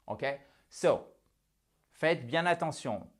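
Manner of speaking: ordinary delivery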